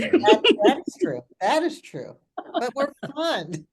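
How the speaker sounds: loud, high, laughing joke. Real, not acted. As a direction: play along with the joke, laughing. Jokingly agrees about secret society.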